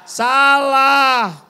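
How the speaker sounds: high, loud, firm rejection; emphatic "wrong". Real, not acted. Preacher loudly corrects the congregation's answer. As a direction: cut the answer down hard.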